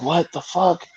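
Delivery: high, rising outrage in his head; exasperated disbelief. Retold or imitated. Comedian reliving his silent outrage at a cocky opener. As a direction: scream it inside at the clueless comic. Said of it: "I'm sitting there like".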